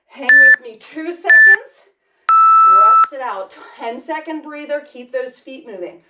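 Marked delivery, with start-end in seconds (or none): 0.1-3.6 shouted rest cue; loud call. Real, not acted. Coach calls rest to class.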